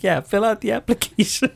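imitating a smug boy, pitched well up; smirking kid. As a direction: say it through a little smirk, daring mom. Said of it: "he's got a little smirk on his face".

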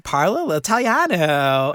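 lightly mocking Valley girl lilt, slow; a hint of sorority girl speaking Italian; slow mock Valley girl line. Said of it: "they sounded like Valley girls".

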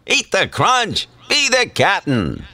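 slow hearty captain; Captain Crunch voice. Shown as a demonstration. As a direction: draw it out like a cereal mascot. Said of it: "Slight variations".

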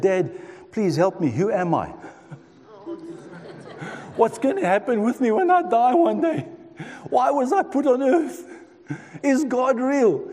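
high, pleading questions to a father, half laughing; a thirteen year old begging his dad for answers; pleading boy. Retold or imitated.

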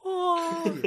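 high-pitched "aw" over a kitten; melting, drawn-out coo. Real, not acted. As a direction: coo at a cute kitty. Cooing over a kitten on camera.

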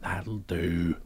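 put-on soft, murmured Scottish accent; softly tries the line in Scottish accent. Cohost echoing the line, murmured in Scottish accent. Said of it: "tempted to do that in a Scottish accent".

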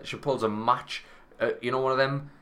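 usual speaking voice

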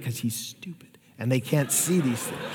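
a quick joking whisper to the crowd; whispered aside. Real, not acted. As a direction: drop to a whisper for the joke. Joking aside about the son.

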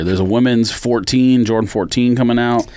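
ordinary talk, nothing marked

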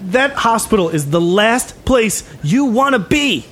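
high, slow warning; high-pitched put-on tough voice; ominous gang leader. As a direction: warn them off with slow weight. Game master plays gang leader.

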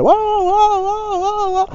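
high, shrill-free mock shout of guau; mock yelling. Shown as a demonstration. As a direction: bark it out way up high as a silly example of yelling. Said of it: "no gritar". (grito exagerado de burla)

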